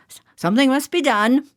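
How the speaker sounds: a fussing father sputtering his complaint; complaining father. Acted. Johnny's father complaining about the children, fast and fretful. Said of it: "Johnny's father complained".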